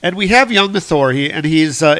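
usual speaking voice

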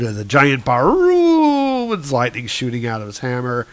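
ordinary talk

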